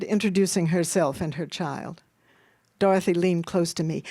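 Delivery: ordinary delivery, nothing marked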